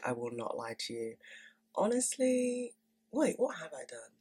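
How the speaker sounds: normal talking voice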